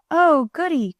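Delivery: put-on mildly excited little boy; delighted cry. A narrator's reading. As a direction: cry out, pleased as can be. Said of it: "cried Bunny".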